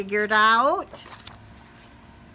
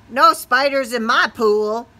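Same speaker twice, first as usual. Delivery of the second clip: grumpy frog; 2 semitones higher, 8 dB louder than usual. Acted. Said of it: "croaked the frog".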